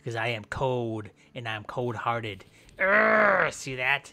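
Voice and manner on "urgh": loud strained attack grunt; effort grunt. Pretend. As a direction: grunt as you strike.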